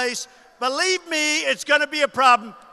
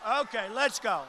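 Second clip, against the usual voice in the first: ordinary talk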